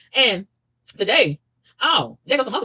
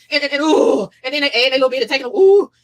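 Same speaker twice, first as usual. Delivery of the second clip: high, louder spluttering ooh; flustered outburst; 5 semitones higher, 7 dB louder than usual. Real, not acted. Ranting about know-it-alls, high and spluttering. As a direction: sputter with irritation.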